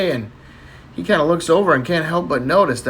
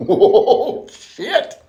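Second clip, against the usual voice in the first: laughing; 9 semitones higher, 4 dB louder than usual. Real, not acted. Laughing reaction.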